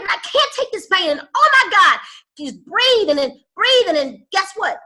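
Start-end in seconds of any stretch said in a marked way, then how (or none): 0.1-1.1 mock anguish. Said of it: "every day that it feels like you're about to quit". 1.2-2.0 loud, high cry of pain; anguished cry. Retold or imitated. Coach voicing someone about to quit, anguished cry. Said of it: "every day that it feels like you're about to quit".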